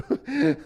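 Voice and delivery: high, loud burst of laughter. Real, not acted. Streamer tells how a game taught a life lesson, laugh. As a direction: laugh at your own story. Laughs at own cringe anecdote.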